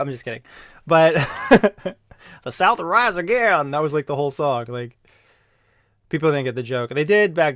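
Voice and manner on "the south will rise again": put-on high drawled Southern accent. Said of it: "in a Southern accent".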